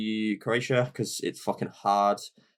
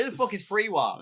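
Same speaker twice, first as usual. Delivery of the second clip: laughing; 9 semitones higher than usual. Retold or imitated. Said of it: "I was like".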